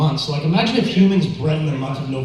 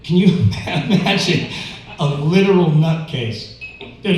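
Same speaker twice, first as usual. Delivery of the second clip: ordinary talk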